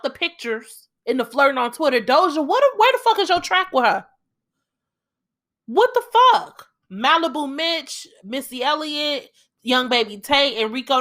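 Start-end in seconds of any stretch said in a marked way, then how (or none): 2.0-6.7 exasperated outburst. Real, not acted. Ranting at artists for not collaborating.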